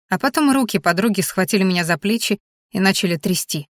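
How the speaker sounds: ordinary delivery, nothing marked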